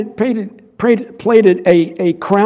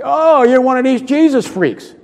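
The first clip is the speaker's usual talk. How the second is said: scoffing; 6 semitones higher, 4 dB louder than usual. Retold or imitated. Scoffer mocking a tract giver.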